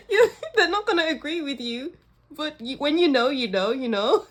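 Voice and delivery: high, giggly, teary voice; real laughter breaking up the words; laughing tearfully. Real, not acted. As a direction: talk through a laugh that verges on crying. Laughing tearfully after emotional moment.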